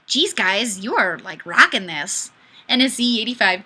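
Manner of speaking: ordinary talk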